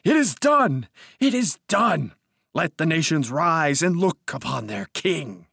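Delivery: high, ringing proclamation; loud, exalted declaiming; triumphant cry. A narrator's reading. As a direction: cry it out to the nations, exultant.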